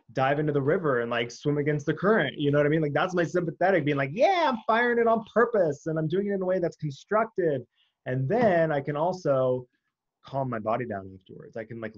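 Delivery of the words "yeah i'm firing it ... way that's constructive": high, loud and fired up; pumped-up inner voice. Pretend. Speaker voicing her sympathetic system, pumped and loud. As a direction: play your revved-up nervous system cheering itself on.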